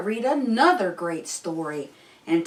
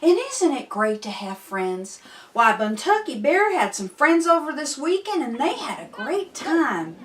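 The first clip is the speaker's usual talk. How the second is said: bright, bouncy host chat to a bear puppet; cheerful kids' show host; 8 semitones higher than usual. Acted. Host chatting to Buntucky Bear, cheerful. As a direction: welcome the kids back like a friendly TV host.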